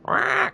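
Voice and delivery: squawking parrot call. Retold or imitated. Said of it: "Doing an impression of a parrot".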